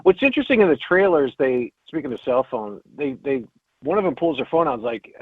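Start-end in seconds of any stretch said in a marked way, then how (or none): none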